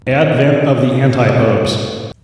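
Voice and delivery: slow, echoing announcer voice; echoey dramatic voice. Acted. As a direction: announce it like a grand, echoing reveal. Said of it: "didn't even have to use any digital special effects".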